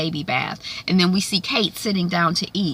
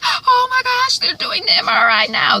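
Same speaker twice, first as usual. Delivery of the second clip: breathless mom; 4 dB louder than usual. Retold or imitated. Mother relieved greeting husband.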